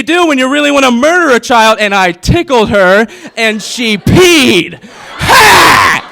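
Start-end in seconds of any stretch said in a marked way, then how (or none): 4.8-6.0 high loud gloating ha; a loud mock gloating ha; triumphant shout. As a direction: crow in victory. Triumphant laugh at sister.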